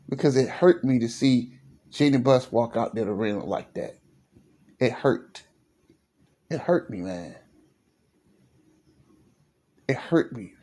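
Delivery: half-joking heartbroken hush; mock emotional. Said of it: "Sorry, I'm getting a little emotional".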